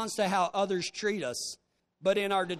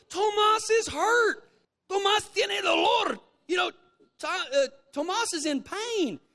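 retold urgent shout; 8 semitones higher than usual. Preacher reenacting yelling at Antonio that Tomas is hurt. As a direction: make him understand it's an emergency. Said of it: "And I said".

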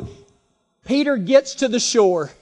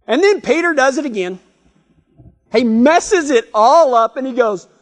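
ordinary talk, nothing marked

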